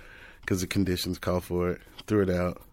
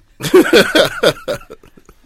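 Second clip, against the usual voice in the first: loud, high burst of laughter; big laugh; 13 semitones higher, 15 dB louder than usual. Real, not acted. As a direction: crack up as the story ends. Laughs after ending the story.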